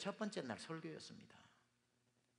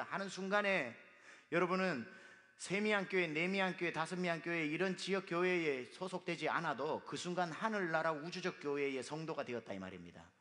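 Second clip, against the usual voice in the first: ordinary talk, nothing marked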